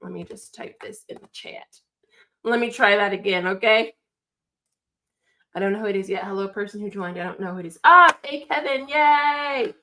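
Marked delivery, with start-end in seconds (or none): 0.0-1.8 whispering to herself while she types; hushed note-to-self whisper; whispered aside. Real, not acted. 7.8-9.7 excited greeting. Real, not acted. First caller shows up.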